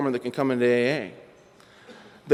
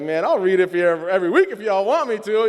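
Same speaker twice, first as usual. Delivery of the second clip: fast, louder eager offer; excited; 5 semitones higher, 4 dB louder, faster than usual. Retold or imitated. Newcomer telling his future sponsor he'll read weekly, eager and fast. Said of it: "I was all excited".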